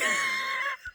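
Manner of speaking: long, very high-pitched laugh; shrieking laugh. Real, not acted. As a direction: squeal with laughter. Laughing at her dog impression.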